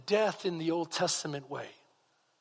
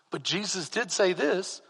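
ordinary talk

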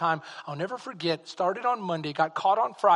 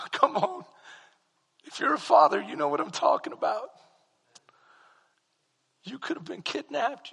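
father crying; 6 semitones higher than usual. Retold or imitated. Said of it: "he starts to cry".

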